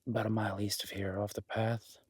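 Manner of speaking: usual speaking voice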